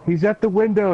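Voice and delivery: a scared child calling out, mildly acted; mock frightened cry. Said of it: "you hear her crying, uh, really loud".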